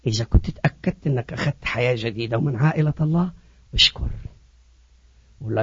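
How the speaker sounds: low, soft pastoral appeal; hushed altar call. Real, not acted. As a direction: lead them gently into prayer, very low.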